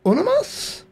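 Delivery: very high put-on Mexican asking; hammed-up hopeful Spanish question; mock Mexican accent. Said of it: "I've got a terrible accent".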